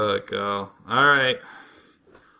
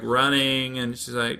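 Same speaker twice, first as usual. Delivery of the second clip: usual speaking voice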